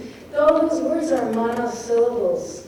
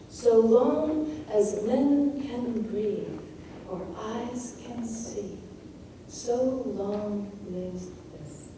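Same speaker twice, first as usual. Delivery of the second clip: slowing to standstill; 6 dB quieter, much slower than usual. Shown as a demonstration. Teacher showing time slowing in the last two lines, hushed and halting. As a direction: let each word halt, sinking into near silence. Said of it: "You're being slowed down to the moment".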